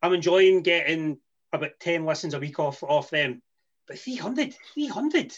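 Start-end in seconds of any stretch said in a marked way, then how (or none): 3.9-5.3 high-pitched disbelief, rising; incredulous. Real, not acted. Host balks at the demand for listens, incredulous. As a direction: repeat the figure in high, questioning disbelief.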